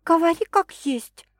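high-pitched, direct demand; urging woman. A narrator's reading. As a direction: press him to come out with it. Woman urges him to speak.

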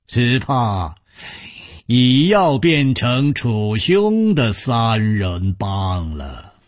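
slow, drawn-out courteous banter; genial gang leader. Acted. Nangong banters politely with Chu, slow genial gang leader. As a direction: stretch the words with genial dignity. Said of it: "南宫绫抱拳笑道".

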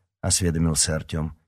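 plain reading voice

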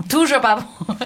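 laughing at herself; lighthearted admission breaking into laughter; amused self-mockery. Real, not acted. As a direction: say it through a rising laugh. Jokes about her own French.

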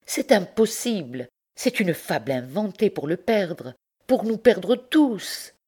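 high, pleading protest that slows at the end; impassioned denial. Acted. Bertrande protests to Pierre, high and impassioned. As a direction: defend him fervently. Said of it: "s'écria-t-elle".

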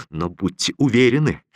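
ordinary delivery, nothing marked

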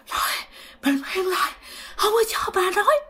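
put-on high, strained croak of a possessed old man; hoarse possessed voice. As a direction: squeeze it out hoarsely, pitched far up. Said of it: "khào khào vậy đó". (giọng khào khào, cao và nghẹn)

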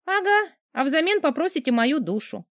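cheeky scoff. A narrator's reading. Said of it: "хмыкнула нахалка".

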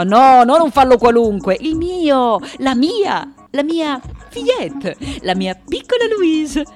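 high, emphatic correction; insistent protest. Acted. Sculptor defending her own fillette, insistent protest. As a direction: climb high insisting on what it is.

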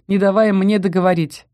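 ordinary delivery

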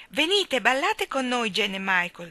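high, loud eager call. A narrator's reading. The twins calling Jane and Michael to dance, loud eager call. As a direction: call them onto the dance floor. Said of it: "gridarono i gemelli".

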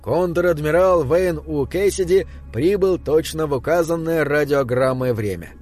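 plain reading voice

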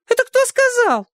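put-on very high shriek of the old neighbour. A narrator's reading. Neighbour shrieks at the swear word. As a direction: shriek it in outrage. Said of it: "взвизгнула миссис Фонтейн".

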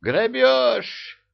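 high, loud indignant cry; outraged high cry. Acted. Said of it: "возмутился эмир".

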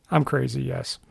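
ordinary delivery

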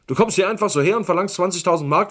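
loud, fast, rising angry tirade; furious outburst. Acted. Uncle furious at blackmail. (wütend, laut und schnell)

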